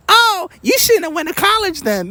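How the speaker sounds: mock critic in a high, sneering pitch. Mocking a critic who blames him for college, in a high put-on voice. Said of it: "come talk to me".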